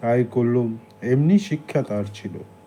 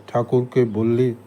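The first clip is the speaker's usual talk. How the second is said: plain reading voice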